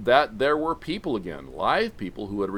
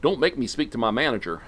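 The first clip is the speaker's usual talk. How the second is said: fast, pushy mock tirade; hammed-up entitled complaint; irate Karen rant; 3 semitones higher, 6 dB louder, faster than usual. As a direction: rattle it off, bossy and fed up. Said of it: "with flaming sticks and yelling".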